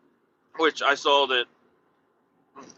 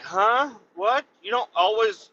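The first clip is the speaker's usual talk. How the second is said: incredulous; 9 semitones higher than usual. Retold or imitated. Retold disbelief at coworker's habit.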